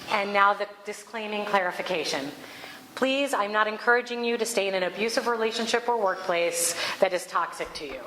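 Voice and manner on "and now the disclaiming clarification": ordinary delivery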